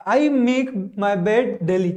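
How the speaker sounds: every word drawn out, very slow; very slow dictation. Shown as a demonstration. Teacher dictating an example sentence to students, very slow. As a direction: spell it out slowly for learners.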